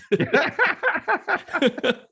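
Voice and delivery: helpless squealing laugh that cracks into a wailed "No"; a laughing fit peaking in a cracked "No"; high laughing. Real, not acted. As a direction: laugh until the word breaks.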